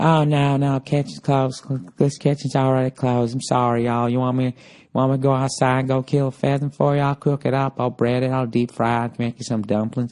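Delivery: mock Southern receptionist, over-hospitable; hammed-up Southern hotel lady; Southern accent. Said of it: "I just like the Southern accent".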